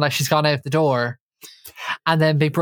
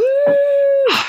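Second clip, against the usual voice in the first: shriek; 5 dB louder than usual. Acted.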